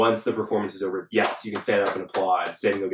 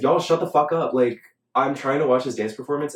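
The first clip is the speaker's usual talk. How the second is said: raised, louder retold complaint; mildly heated mock outburst at noisy crowd; exasperated telling-off; 5 semitones higher, 4 dB louder than usual. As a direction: act out fed-up audience member. Acted annoyance at screaming audience.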